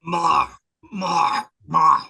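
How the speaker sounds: loud, hungry demand; greedy growl. Retold or imitated. Steve devouring candy, loud greedy growls.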